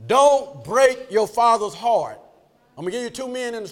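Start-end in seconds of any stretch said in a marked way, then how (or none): 0.1-2.2 slow, loud, weighty exhortation; preaching at full force. Real, not acted. Preacher exhorting the congregation, preaching forcefully. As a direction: drive each word home slowly and loudly.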